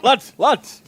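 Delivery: a hint of a Northern caveman calling his lads; caveman accent. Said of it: "With the accent".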